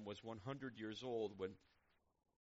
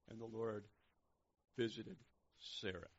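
gently. Acted. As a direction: let each piece fall gently. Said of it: "gently says".

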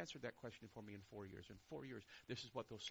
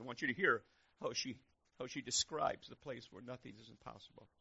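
usual speaking voice

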